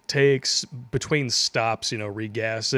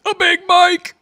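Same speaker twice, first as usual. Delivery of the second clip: shout; 11 dB louder than usual. Real, not acted. Shouted punchline.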